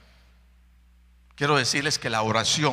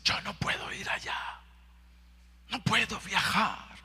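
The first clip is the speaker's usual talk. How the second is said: whispered complaint; 7 semitones higher, 5 dB quieter than usual. Acted. Voicing a discouraged believer.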